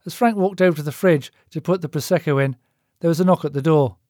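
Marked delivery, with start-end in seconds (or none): none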